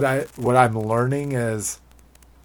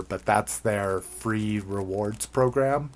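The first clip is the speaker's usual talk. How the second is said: normal talking voice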